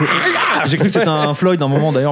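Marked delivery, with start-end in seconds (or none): 0.0-0.6 high shrieked witch cry "Ah"; a quick mock witch screech. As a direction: mimic the shrill witch vocals. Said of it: "un mec qui fait".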